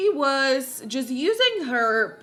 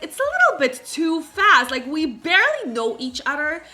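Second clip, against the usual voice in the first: raised, louder retold objection; mock protest; 4 semitones higher, 7 dB louder than usual. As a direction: protest lightly on someone else's behalf. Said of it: "although he was like".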